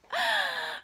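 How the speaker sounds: high, loud spider laughter; hearty laugh. Acted. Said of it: "Charlotte laughed so hard".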